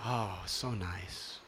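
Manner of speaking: slow, soft, dreamy oh so nice; blissful sigh. Retold or imitated. Devotee floating under moonlight, slow soft bliss. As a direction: melt into the water slowly.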